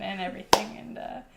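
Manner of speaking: usual speaking voice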